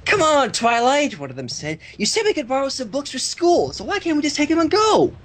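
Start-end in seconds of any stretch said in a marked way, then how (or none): 0.0-1.2 filly voice. A narrator's reading. 2.0-5.1 filly voice. A narrator's reading.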